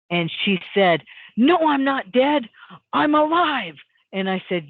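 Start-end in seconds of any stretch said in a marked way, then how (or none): 1.4-3.8 retold angry denial, high and loud; raised, high-pitched angry quote; really angry. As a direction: imitate an indignant, insistent protest. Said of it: "like, really angry".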